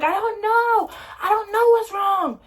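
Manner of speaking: high, loud tearful wail; crying. Retold or imitated. Daughter crying to her mother through the door, high and wailing. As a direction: wail it like you can't stop crying.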